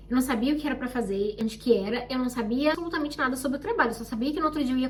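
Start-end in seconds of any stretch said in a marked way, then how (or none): none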